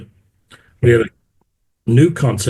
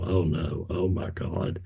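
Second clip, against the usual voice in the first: dismayed mutter; 5 semitones lower, 11 dB quieter, slower than usual. Retold or imitated. Sees nineteen hundred yards carpet.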